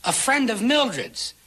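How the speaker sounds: plead, rising in panic; desperate pleading. Acted.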